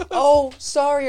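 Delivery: high, loud little-girl voice, mock sorry; daughter's fake apology. Father imitates his daughter apologizing after punching him, high and loud. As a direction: play the cheeky kid saying a fake sorry. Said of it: "She's like".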